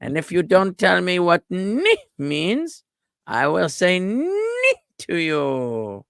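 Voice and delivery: high, drawn-out mock-menacing knight voice; Knight who says Ni. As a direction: threaten in a silly high knight voice. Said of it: "We are the knights who say ni".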